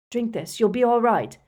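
desperate, pleading comfort. A narrator's reading. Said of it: "said Harry desperately".

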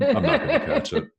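high, quick laughing words. Real, not acted. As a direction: laugh through the line. Laughing joke about covid.

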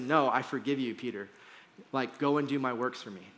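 quiet, gentle reassurance; soft forgiveness. Retold or imitated. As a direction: let the words fade tenderly. Jesus answers Peter.

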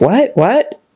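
puzzled What? What? rising high; mock confusion; confused listener. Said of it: "makes your listener think".

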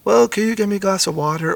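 put-on a small kid's sleepy ask; child's request. Daughter asking dad for water at night, lightly voiced child. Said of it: "as if some tragedy was like".

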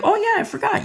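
very high-pitched mock remembering; squeaky-high imitation of an afterthought; Pilate's sudden realization. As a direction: act out a ruler suddenly remembering, voice leaping up. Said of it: "Pilate was just thinking like".

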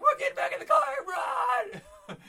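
high, slow, drawn-out scream; full-volume retold panic; mock panicked shout. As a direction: yell like you're fleeing in terror, hammed up. Retelling the panicked flight to car.

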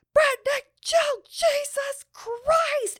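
high, drawn-out enraged shout; furious yelling. Retold or imitated. Furious Nana hollering at her grandchild over the horn, imitated yell. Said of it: "she is yelling so much".

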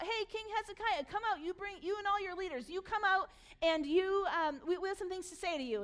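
high, mock enemy summons; calling out. Enemy army summoning Hezekiah out of Jerusalem, calling out high. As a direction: call up to a king from outside the city. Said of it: "and they call out".

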